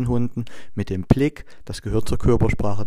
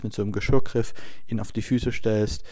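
ordinary talk, nothing marked

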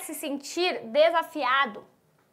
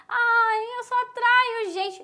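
higher, whiny mock complaint; mock whining; 7 semitones higher, slower than usual. Imitating a whiny student, higher mock whine. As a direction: whimper it like a self-pitying girl. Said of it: "E aí fica choramingando".

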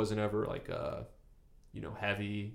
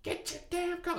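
imitating mom; 3 dB quieter than usual. Mom scolding her son about his coat, high mock scold. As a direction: squeak it like an exasperated mom. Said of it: "only time she's ever yelled".

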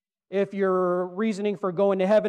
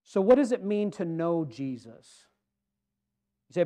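ordinary talk, nothing marked

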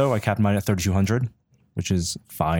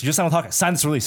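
insistent demand, retold at a higher pitch; adamant; 6 semitones higher, much faster than usual. Release man pushes photographer to sign, imitated adamantly. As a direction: press for a signature, imitating a pushy stranger. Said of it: "He was really adamant".

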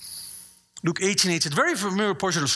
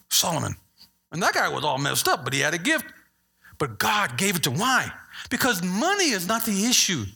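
ordinary talk

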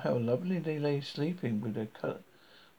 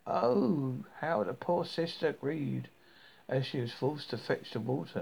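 ordinary delivery, nothing marked

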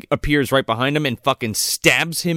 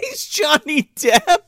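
very high, shrieking laugh; slower than usual. Real, not acted. Friend joking about Grindelwald, squealing with laughter. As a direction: crack up, squealing. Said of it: "he's Grindelwald".

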